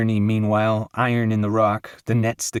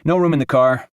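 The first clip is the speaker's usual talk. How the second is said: ordinary delivery, nothing marked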